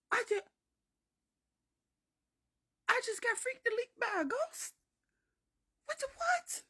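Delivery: high, halting squeak of shock; stunned disbelief. Retold or imitated. Narrator learning a ghost got them, stunned squeaking. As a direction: squeak it out haltingly, hammed-up horror. Stunned reaction to granny's explanation.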